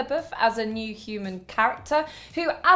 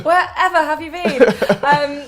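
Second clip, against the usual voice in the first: laughing; 5 semitones higher, 8 dB louder than usual. Real, not acted. Laughing welcome back.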